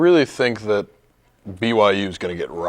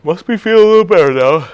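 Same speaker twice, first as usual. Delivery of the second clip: higher, louder mock-cheerful; sarcastic; 8 semitones higher, 7 dB louder than usual. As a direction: chirp it with fake enthusiasm. Teasing, bored reply.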